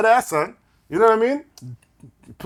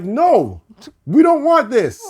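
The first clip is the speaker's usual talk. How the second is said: rising, louder mock protest; protesting fans; 8 semitones higher, 6 dB louder than usual. Fans demanding the old theme back, rising mock protest. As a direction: fans rejecting the new song, growing louder. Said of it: "people were like".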